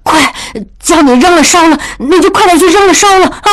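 high, fast anxious urging; hurried urging. Acted. Mother hurriedly urges maid to obey daughter. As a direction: rush the maid along. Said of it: "江母急忙对翠儿说".

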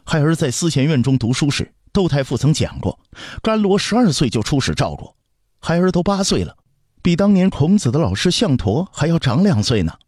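put-on high-pitched boy arguing his case; stubborn, confident child. Prince argues his age is no obstacle, stubborn and confident. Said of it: "透出倔强和自信".

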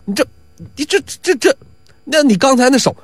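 high, tongue-tied protest; rattled young watchman, pitch jumping up; flustered stammer. Acted. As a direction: trip over the words, unable to answer. Watchman stammers before his accuser.

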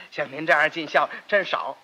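normal talking voice

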